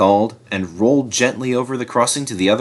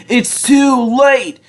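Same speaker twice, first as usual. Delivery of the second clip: groaning despair; 13 semitones higher, 4 dB louder, slower than usual. A narrator's reading. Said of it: "Duck groaned".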